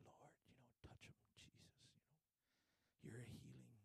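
whispered prayer at a bedside; quiet hospital prayer. Retold or imitated. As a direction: whisper it so nobody is disturbed. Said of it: "you're being quiet".

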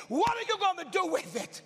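loud and high, booming the question; God's shouted challenge. Retold or imitated. God challenging the preacher in his dream, booming. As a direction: call it out like a command.

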